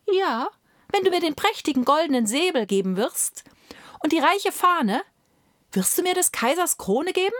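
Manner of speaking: high, quick, bargaining bird voice; small songbird coaxing Death, pitched well up; voiced little nightingale. A narrator's reading.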